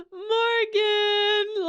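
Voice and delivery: high, drawn-out cautious call; wary calling out. Acted. Listener imitating the wary call to Morgan, tentative. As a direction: call a name as if afraid of who answers. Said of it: "you're, like, afraid of them".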